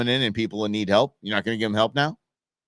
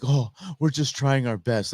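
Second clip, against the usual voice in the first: mock sincere excuse; 3 semitones higher than usual. Mocking the secretary's excuse.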